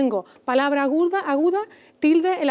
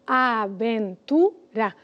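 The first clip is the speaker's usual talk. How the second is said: "aventura" as a, ven, tu, ra; slow "aventura", a gap after each syllable; split into syllables; 2 semitones lower, much slower than usual. Shown as a demonstration. Teacher separating the word into syllables, slowly. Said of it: "pues separaríamos".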